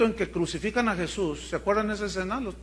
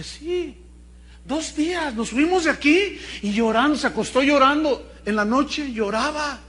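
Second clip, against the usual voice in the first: higher, urgent mother's voice; emphatic retelling; 7 semitones higher, 5 dB louder than usual. Retold or imitated. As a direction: insist on the two days of crying. Mother tells of crying daughter.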